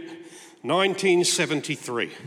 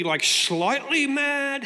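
slow, higher mock-innocent query; slow, higher teasing question to his wife; 7 semitones higher, much slower than usual. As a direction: drawl it out as a cheeky tease.

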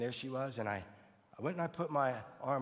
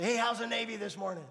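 cheery teasing hello; 8 semitones higher, 7 dB louder than usual. Retold or imitated. Teasing greeting to the Navy vet.

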